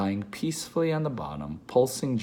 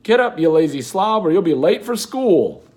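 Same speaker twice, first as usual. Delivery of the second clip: much higher, louder shout; father's wake-up yell; 8 semitones higher, 11 dB louder than usual. Retold or imitated. Father shouting at his son to get up. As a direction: bellow to rouse a lazy son. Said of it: "my father's real voice".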